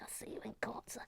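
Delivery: very soft, half-whispered mock threat; hushed, jokey indignation; mock outrage. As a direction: threaten under your breath in jest. Mock outrage, first muttered take.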